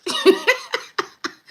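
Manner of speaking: high, loud burst of laughter; real laugh. Real, not acted. Laughing at own sandwich joke, high cackle. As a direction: crack up at your own joke.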